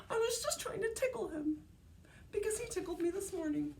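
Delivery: sister explaining. Acted. Sister voiced in story.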